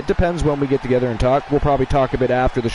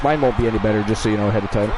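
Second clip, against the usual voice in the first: ordinary delivery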